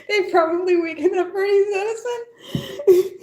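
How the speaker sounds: high voice squeezed by laughter; laughing. Real, not acted. Streamer cracking up at an Edison joke, laughing.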